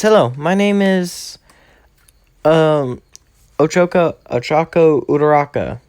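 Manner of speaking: a hint of an eager schoolgirl; girly voice. Uraraka introduces herself.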